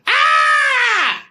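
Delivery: long high-pitched yell; sudden held screech on one high note; lion's scary roar. Acted. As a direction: imitate a scary face with a piercing cry. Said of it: "And the lion goes".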